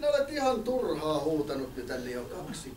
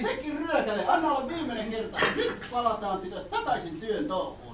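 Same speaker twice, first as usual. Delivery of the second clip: flustered villager woman; 5 semitones higher than usual. Acted. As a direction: squeal in a panic like a fussy villager. Scolding villager.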